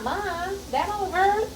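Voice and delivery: put-on high, worried little-girl voice for the daughter; imitating daughter. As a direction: ask it like a concerned kid. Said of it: "My daughter asks all the time".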